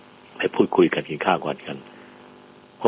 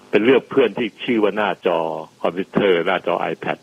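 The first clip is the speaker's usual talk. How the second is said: ordinary delivery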